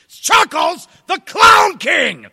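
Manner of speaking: very high, very loud drawn-out announcement; shout for everyone. Shown as a demonstration. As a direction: bellow it so the whole crowd hears. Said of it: "So that everyone can hear".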